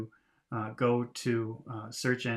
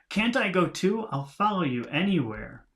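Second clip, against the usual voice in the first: higher, louder eager plea; 7 semitones higher, 4 dB louder than usual. Acted. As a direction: beg to tag along, pitch up. Cuthbert pleading to follow bird.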